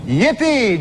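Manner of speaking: loud, high shout of joy; a whoop of mock delight; cheery yippee. Shown as a demonstration. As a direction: cheer it out gleefully. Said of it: "That should read, uh, "Yippee!".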